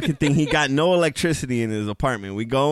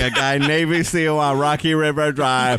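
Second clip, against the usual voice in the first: Forrest Gump. Retold or imitated. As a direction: mimic the customer's odd inflection. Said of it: "guy who talked like Forrest Gump".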